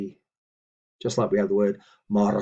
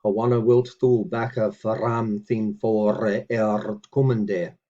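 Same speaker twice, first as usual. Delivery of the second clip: slow, deliberate word-by-word recitation; measured teaching read; careful Old English reading; 4 dB louder, slower than usual. Shown as a demonstration. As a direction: read each word out for the learner. Reads Old English model sentence.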